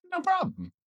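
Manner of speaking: soft, high-pitched meme squeak; tiny high voice quoting a meme; meme imitation. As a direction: flip up high but keep it small. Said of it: "like the one meme".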